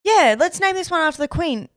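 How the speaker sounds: colonists. Retold or imitated. Colonists naming Queensland.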